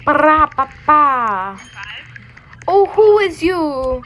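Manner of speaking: high, loud, slow sassy taunt; sing-song it then get sassy; mock sassy greeting. Streamer mocking a game character, sassy slow taunt.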